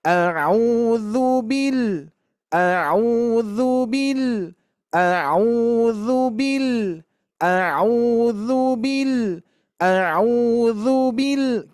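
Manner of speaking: bil ditekan naik lalu turun panjang; stress on bil. Shown as a demonstration. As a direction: tekan suku kata "bil" lalu tahan. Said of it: "kita praktekkan cukup lima kali". (tekanan pada "bil", dibaca pelan)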